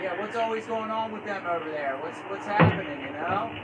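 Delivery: far from mic. Real, not acted. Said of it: "you're very faint again".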